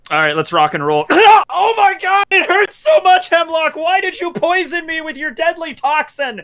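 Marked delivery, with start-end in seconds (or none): 1.6-6.4 high, loud mock wailing; badly faked dying cry; hammed-up fake agony. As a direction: overplay being poisoned. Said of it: "I pretend to fall on the ground".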